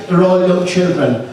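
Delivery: ordinary delivery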